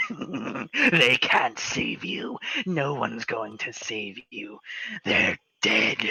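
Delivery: slow, drawn-out sinister threat; menacing hag. Acted. Possessed grandma taunting the strangled player, slow menacing. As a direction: savor each word of the threat. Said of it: "she's like".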